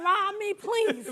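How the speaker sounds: loud, hammed-up plea; mock screaming. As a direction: play a tourist begging to be robbed. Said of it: "You screaming".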